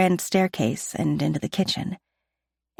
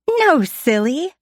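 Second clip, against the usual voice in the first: put-on high, slow, giggling child; giggly little girl; 10 semitones higher, 5 dB louder, slower than usual. A narrator's reading. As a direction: tease him playfully, much higher. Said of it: "Sasha giggles".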